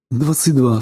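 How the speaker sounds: ordinary delivery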